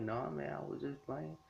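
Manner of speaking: a hint of a nervous retreat, voice dropping away; sheepish backing down. Whis backing down from Beerus.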